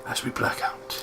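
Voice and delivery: unvoiced dramatic whisper. Narrator closes the scene, a dramatic whisper. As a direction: breathe it like a scene fading out.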